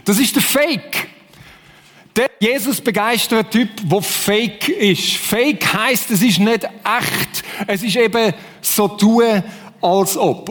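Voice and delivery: usual speaking voice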